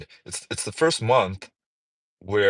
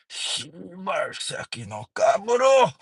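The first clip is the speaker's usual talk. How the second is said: slow, committed mock Japanese pronunciation; 5 semitones higher, slower than usual. Shown as a demonstration. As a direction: say it from the gut like a native. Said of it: "gotta come from your kimchi".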